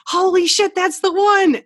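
high, loud burst of disbelief; retold holy-shit moment, high and loud; excited exclaim. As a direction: blurt it like you can't believe your luck. Excited retelling of finding trailer.

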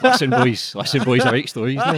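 high, laughing put-on announcement to the boys; giggling through a pretend confession; laughing mock announcement. Telling his mates he writes stories, laughing.

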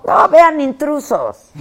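shouted plug. Pretend.

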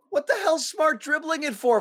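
exasperated outburst. Real, not acted. Frustrated rant about Smart.

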